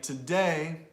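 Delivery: today with DAY strong and long, TO reduced; light quick "to", big "DAY" in today; stress on DAY. Shown as a demonstration. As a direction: lean on the second syllable of today. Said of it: "stresses the day, not the t".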